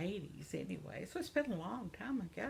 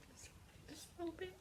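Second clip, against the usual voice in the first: coy aside; 11 semitones higher, 15 dB quieter, much faster than usual. Pretend. Coy aside about crocheting.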